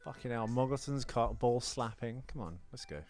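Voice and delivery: ordinary talk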